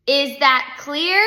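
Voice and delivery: forceful teacher demanding agreement; insistent repeat. A narrator's reading. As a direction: spell it out slowly and loudly. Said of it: "she asked again".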